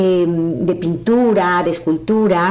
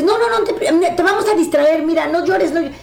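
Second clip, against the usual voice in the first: higher, hurried voice of friends hushing a crier; imitating fussy friends; 7 semitones higher than usual. Speaker mimicking friends who tell a crying person not to cry, in a higher, fussing voice. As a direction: play well-meaning friends talking someone out of tears. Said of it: "Y los amigos".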